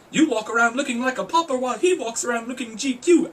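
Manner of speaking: high, loud TV psychic with fake Jamaican accent; Miss Cleo. Vlogger mimicking an old psychic commercial, loud mock Jamaican accent. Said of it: "I used to love those Miss Cleo commercials".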